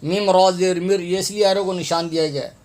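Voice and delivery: ordinary delivery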